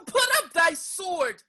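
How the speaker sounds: high, loud cry of alarm; shout. Acted. Woman shouts at the men to stop. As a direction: throw it out to stop the fight. Woman stops a sword fight.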